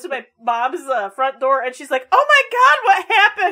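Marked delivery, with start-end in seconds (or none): 2.1-3.5 high, loud mock alarm; retold startled outcry; alarmed mom. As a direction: imitate a mother crying out in concern. Voicing alarmed mom at front door.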